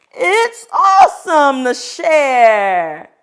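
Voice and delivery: Joe's voice. Acted. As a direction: say it slow and proud as Joe. Joe's line in story.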